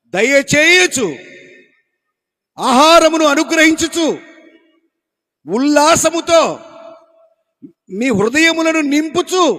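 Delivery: shouting scripture without a mic; loud preaching. Acted. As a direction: call it out slowly across the hall. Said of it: "ఆయన బిగ్గరగా చెప్పాడు కానీ నేను కూడా బిగ్గరగా అరుతున్నా".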